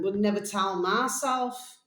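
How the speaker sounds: normal talking voice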